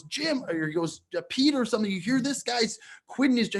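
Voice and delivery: high-pitched, incredulous retelling; retold astonishment. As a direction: imitate him announcing astonishing news to friends. Astounded guest telling the table.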